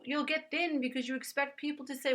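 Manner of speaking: ordinary delivery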